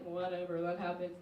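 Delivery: low, soft, dismissive; dismissive shrug. Retold or imitated. Friends shrugging off debt, low dismissive mumble. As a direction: brush it off. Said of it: "everyone's like".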